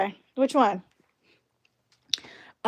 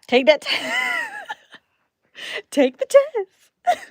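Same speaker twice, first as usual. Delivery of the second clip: laughing mock urging; 5 dB louder, much slower than usual. Friends urging a pregnancy test.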